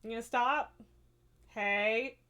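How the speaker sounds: ordinary talk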